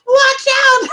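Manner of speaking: very high, loud shouted warning; retold yell. Lady yelling at the helper as her van door drops, high shout. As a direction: shriek it as the door comes down.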